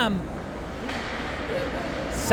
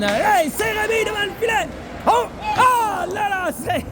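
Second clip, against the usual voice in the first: excited sports commentary; 9 semitones higher than usual. Real, not acted. Scoring chance in play-by-play.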